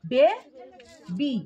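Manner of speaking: বে, a pause, then বি; split into syllables. Shown as a demonstration. Teacher repeats the two syllables for pupils, split apart. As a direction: say বে and বি as two separate beats. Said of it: "দুটো শব্দ হলো".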